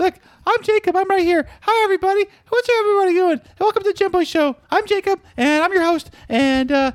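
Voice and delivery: high, put-on show host voice; high-pitched mock host intro; Jacob. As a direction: greet the audience in a high mimicking voice. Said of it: "your mom sent me a picture of Jacob".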